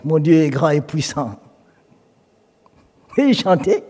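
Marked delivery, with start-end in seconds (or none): none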